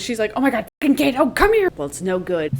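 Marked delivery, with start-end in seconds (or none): 0.3-1.7 high, rising frantic call, louder on the end; panicking. Acted. Clove pinned by Thresh, calling for Cato in high panic. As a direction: scream for help as it falls apart. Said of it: "Starts panicking".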